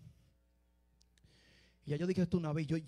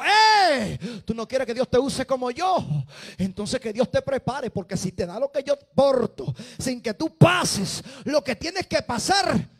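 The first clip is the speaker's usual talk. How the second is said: loud, high, stern rebuke; man of God rebuking; 6 semitones higher, 13 dB louder, slower than usual. Retold or imitated. Man of God rebuking the naive man, loud and stern. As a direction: warn him firmly. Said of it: "se le acercó y dijo".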